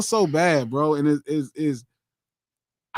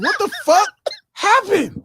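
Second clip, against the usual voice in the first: high, incredulous outburst; shocked disbelief; 10 semitones higher than usual. Retold or imitated. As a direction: let the pitch leap in mock shock. Retelling his shock at the accent.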